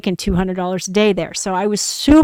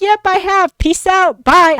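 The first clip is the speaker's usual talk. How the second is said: bright, high, breezy sign-off; cheery goodbye; 10 semitones higher, 6 dB louder than usual. Retold or imitated. Quitting on the boss with a cheery high goodbye. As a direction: chirp it as she walks out. Quitting, mocking boss's question.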